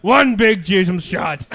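very slow put-on voice; hammed-up, drawn-out announcement; another comic character. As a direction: stretch each word out in character. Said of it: "that's another character".

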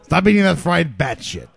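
loud heckle, cracking up; laughing shout. Pretend. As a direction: yell it through laughter.

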